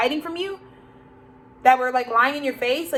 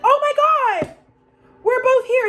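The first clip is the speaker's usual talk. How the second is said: high, loud squealed mock surprise; fake surprise; 12 semitones higher, 6 dB louder, slower than usual. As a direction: overact bumping into him by chance. Mocking staged surprise meeting.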